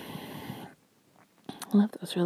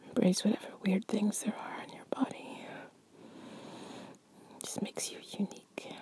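soft ASMR whisper; 9 dB quieter than usual. Acted. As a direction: breathe it to your partner.

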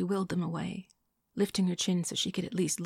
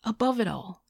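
higher, louder, gaining momentum; picking up steam; 5 semitones higher, 6 dB louder than usual. A narrator's reading. Man explaining her flaw, picking up steam. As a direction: press on with growing force. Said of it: "picking up steam now".

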